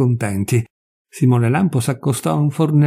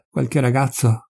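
usual speaking voice

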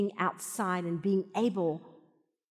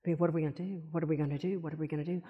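anxious fretting; 4 semitones lower, 4 dB quieter, much faster than usual. Retold or imitated. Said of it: "all this anxiety over there going like".

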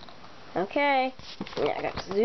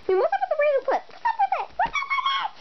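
climbing higher with each repeat; escalating disbelief, pitch rising; mock outrage climbing up the scale; rising incredulous rant; much faster than usual. Ranting about the clip.

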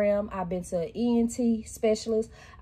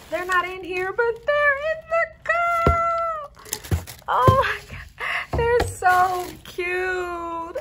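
delighted squeal; 14 semitones higher, much slower than usual. Real, not acted. Delighted finding straw toppers.

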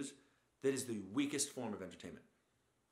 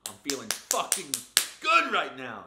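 high, much louder mock yell; obnoxious hype; 4 semitones higher, 13 dB louder than usual. A husband coming home to his wife, in loud obnoxious hype. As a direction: bellow it like a pumped-up jerk. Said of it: "so you're like".

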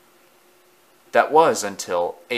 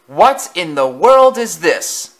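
higher, louder, slow shock; startled outburst; 7 semitones higher, 8 dB louder, slower than usual. A narrator's reading. Bully startled by Henry on the turntable. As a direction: cry out at the sight. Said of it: "startled engine".

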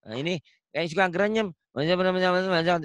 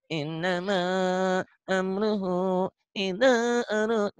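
slow chant voiced through the nose; nasal Quran recitation; 2 semitones higher, much slower than usual. Shown as a demonstration. Teacher showing the nasal style some ustadz use, slow nasal chant. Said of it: "ada beberapa ustadz yang ke hidung".

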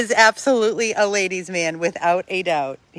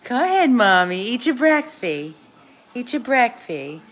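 higher, sing-song coaxing of a dog; coaxing pet talk; 5 semitones higher, 3 dB louder than usual. Real, not acted. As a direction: sweetly urge a little dog to eat.

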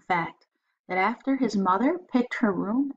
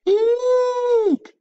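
very high, loud frightened squeak; louder, more panicked squeak; 9 dB louder than usual. Acted. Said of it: "Billy squeaked again".

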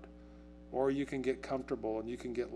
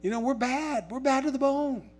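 put-on much higher, louder bragging kid voice; hammed-up swaggering player, pitched way up; cocky kids; 11 semitones higher, 7 dB louder than usual. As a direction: strut and boast like a teen who beat the good team. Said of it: "'Cause you're going".

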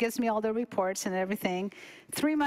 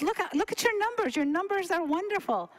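imitated delight, voice pitched up high; high-pitched mock-proud doctor; happy cardiologist; 8 semitones higher than usual. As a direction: gush proudly like the pleased doctor. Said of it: "comes in all smiling and just happy".

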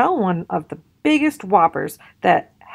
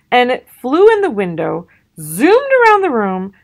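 excited cubs; 5 semitones higher, 5 dB louder than usual. A narrator's reading. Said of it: "continued the cubs".

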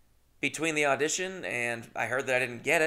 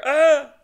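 sudden high yelp of pain; Ray Romano yelp; 14 dB louder than usual. Retold or imitated. Imitating Ray Romano burning his finger, high yelp. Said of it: "He was just going like".

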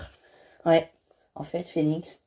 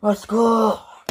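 drawn-out cry; 7 semitones higher, much slower than usual. Acted. Woman screaming for help.

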